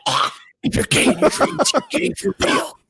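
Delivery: very high, squeaky laughing shriek; squealing realization. Pretend. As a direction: shriek it out as if shocked awake. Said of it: "I'm gonna wake up and be like".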